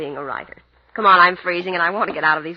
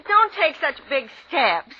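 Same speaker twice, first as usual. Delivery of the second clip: ordinary talk, nothing marked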